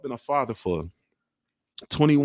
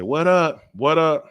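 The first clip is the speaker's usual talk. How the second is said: loud, higher relayed shout-out; calling out; 4 semitones higher, 5 dB louder, slower than usual. Real, not acted. Dad relays the kids' greeting back, calling out. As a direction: holler the greeting across.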